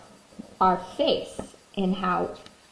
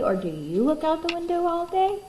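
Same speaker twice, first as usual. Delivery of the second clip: high playful voice; 8 semitones higher than usual. Acted. Playful question to children.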